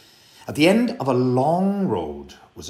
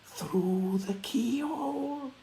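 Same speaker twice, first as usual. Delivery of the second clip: eerie; 4 dB quieter, slower than usual. A narrator's reading. Ghostly voice through keyhole.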